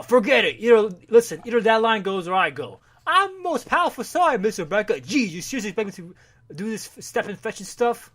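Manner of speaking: angry actor. Retold or imitated. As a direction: play the actor refusing the line, heated. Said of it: "lead is angry".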